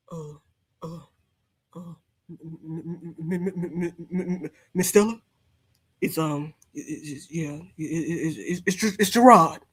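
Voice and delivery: low, stammering, nervous servant voice; timid houseboy voice. Acted. Host mocking Gerard as Stella's timid houseboy, low and stammering. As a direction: stutter it out like a scared houseboy at the door. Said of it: "You probably gave Stella a bath".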